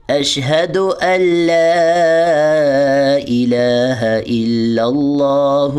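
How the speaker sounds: full shahada drawn out; slow recitation. Shown as a demonstration. Teacher reciting the shahada for the class. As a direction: recite slowly and carefully. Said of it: "اس پہ آپ نے احتیاط رکھنی ہے".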